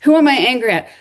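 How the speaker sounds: normal talking voice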